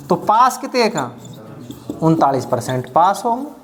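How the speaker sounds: ordinary talk, nothing marked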